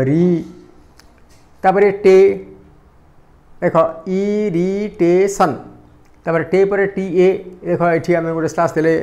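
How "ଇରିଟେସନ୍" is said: "Irritation" slowly split as ଇ-ରି-ଟେ-ସନ୍; "irritation" in four measured beats; syllable by syllable. Shown as a demonstration. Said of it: "ମୁଁ ଯେତେବେଳେ ଉଚ୍ଚାରଣ କରୁଛନ୍ତି".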